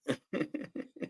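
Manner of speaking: soft high laugh; a quick giggle; a light amused giggle. Real, not acted. Laughing at Raven.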